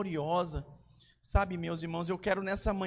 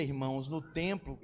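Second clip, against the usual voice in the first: ordinary delivery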